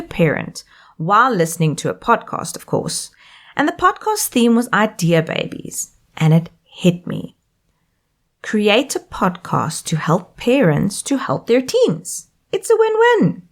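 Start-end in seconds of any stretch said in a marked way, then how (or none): 2.6-3.1 a breathy, unvoiced "of course" tossed off to the side; whispered aside. Real, not acted. Host whispering a wry aside to listeners, unvoiced. 12.5-13.4 excited. Real, not acted. Host recalling her sudden idea, bright high exclamation.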